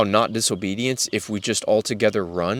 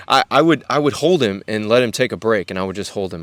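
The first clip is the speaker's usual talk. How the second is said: ordinary delivery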